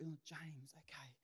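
unvoiced grudging whisper; whispered resignation to scripture. Real, not acted. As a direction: sigh it out under your breath.

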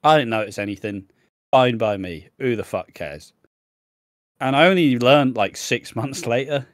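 normal talking voice

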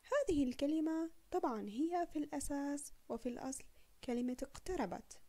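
usual speaking voice

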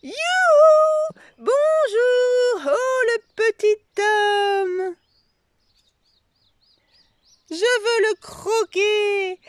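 very high, loud playful ogre-granny voice, slowed; grannies shrieking with delight. A narrator's reading. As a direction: pretend to gobble the baby up. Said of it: "chacune s'écrie". (mamies qui crient de joie, voix d'ogresse pour rire)

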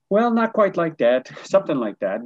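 evasive hedge. Retold or imitated. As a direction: stall and squirm out of it. School official hedging awkwardly.

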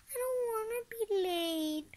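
put-on high whiny child's wail; crying out. A narrator's reading. Ross afraid of being late, high whiny cry. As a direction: cry it out like an upset little kid. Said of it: "cried La-, Ross".

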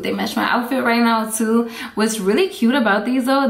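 normal talking voice

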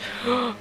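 high startled gasp; sudden gasp of surprise; sharp gasp. Real, not acted. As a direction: catch your breath in shock. Shocked gasp at crash.

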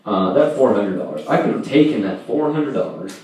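normal talking voice

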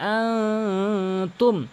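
আনতুম hummed nasally; nasal ghunna; through the nose. Shown as a demonstration. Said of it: "নাকের ভিতর নিয়ে উচ্চারণ করবেন".

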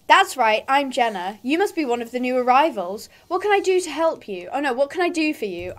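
usual speaking voice

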